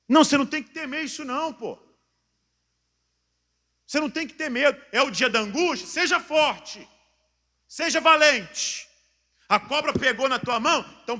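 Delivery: high, loud exhortation; full-force preaching. Real, not acted. Preacher urging believers to be strong in distress, full-force preaching. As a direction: drive the congregation to courage at a shout.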